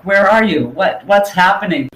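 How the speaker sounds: ordinary delivery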